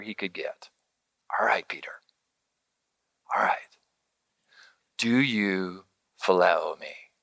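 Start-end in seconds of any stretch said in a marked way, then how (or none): 1.4-7.1 a hushed, tender Jesus whisper; gentle whisper. Acted. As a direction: breathe it like a comforting secret. Jesus meeting Peter gently.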